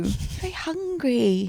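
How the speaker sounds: put-on slow, high childish voice; cutesy voice. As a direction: draw it out like a little kid. Playful baby voice about her schoolgirl self.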